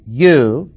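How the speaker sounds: "You" said strongly and stretched, standing alone; stress on You. Shown as a demonstration. Teacher marking the verse's second contrast, stressing "You". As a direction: lean hard on "You" as the emphatic word. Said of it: "I will stress the four emphatic pronouns".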